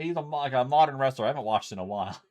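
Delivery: normal talking voice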